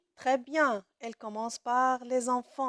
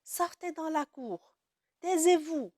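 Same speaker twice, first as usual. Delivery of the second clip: high, louder commanding tone; mock order; 5 semitones higher, 3 dB louder than usual. Shown as a demonstration. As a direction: bark it like an order to the class. (ordre donné d'un ton autoritaire)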